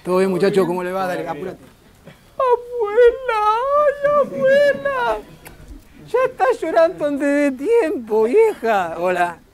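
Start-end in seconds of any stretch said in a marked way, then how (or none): 2.4-5.2 high, long-drawn wail; theatrical cry to grandma, vowels stretched high; mock wailing. Mock emotional greeting of grandmother. 6.1-9.0 high, slow teasing lament; hammed-up whine easing off; mock lament. Teases the grandmother after wailing.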